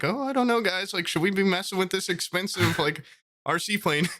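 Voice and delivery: mock voice of reason. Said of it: "voice of reason".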